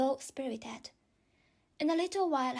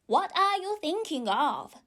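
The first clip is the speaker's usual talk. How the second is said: severe; 6 semitones higher, 4 dB louder than usual. A narrator's reading. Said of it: "said the mouse to Alice severely".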